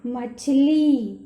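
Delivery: मछली with the final ई stretched slow and loud; drawn-out long ई. Shown as a demonstration. Teacher stretches the final ई of मछली for the class. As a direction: hold the last ई of मछली. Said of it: "खींच कर बोलना है".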